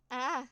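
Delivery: sharp loud yelp of pain; pained cry. Acted. Bitten by mice in the dark, a loud pained yelp. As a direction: cry out as teeth sink in. Mice bite your leg.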